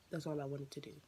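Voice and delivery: ordinary delivery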